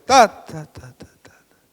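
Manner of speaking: first ta loud, the rest dropping to a whisper; fortepiano demo. Shown as a demonstration. Teacher showing fortepiano, loud then whispered. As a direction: hit the first ta hard, then fall to nothing. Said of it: "¿Qué es lo que es el fortepiano?".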